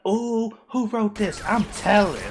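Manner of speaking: high, slow, sing-song tattling; mock a snitch about to tell; hammed-up tattletale voice; tattletale classmate.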